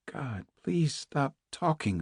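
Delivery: soft, slow, strained inner plea; silent inner scream. Acted. Captive silently willing the other woman to stop, screaming inwardly. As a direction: beg under your breath, slowly. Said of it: "a voice inside Naomi was screaming".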